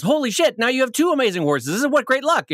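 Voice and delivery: high, quick burst of astonishment; amazed neighbor. Acted. Neighbor to horse owner after the horse returns, amazed. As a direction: marvel at a friend's good fortune.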